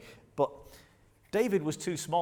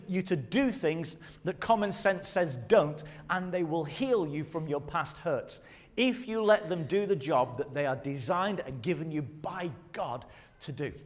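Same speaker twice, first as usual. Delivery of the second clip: usual speaking voice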